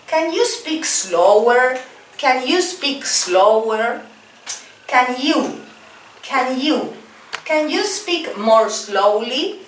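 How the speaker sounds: slow, high teacher model phrases; slow drill model. Shown as a demonstration. Teacher drilling students, slow careful repeat-after-me model. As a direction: say it slowly so the class can echo. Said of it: "repeat after me, please".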